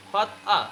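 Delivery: ফাদ আ sounded out in two parts without R. Shown as a demonstration. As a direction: break it as "fad, aa". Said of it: "কি হবে?". (ফাদ আ, র ছাড়া ভেঙে বলা)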